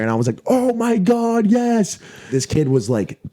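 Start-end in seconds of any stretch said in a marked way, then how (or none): 0.5-2.0 high, slow cry of joy; reliving the win, a high joyful cry; elated. Retold or imitated. Celebrating the winning shot.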